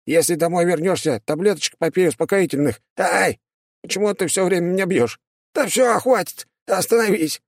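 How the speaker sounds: high squealing demon being hit; whiny imp crying out under blows; yelping demon. Acted. Demon beaten, yelps.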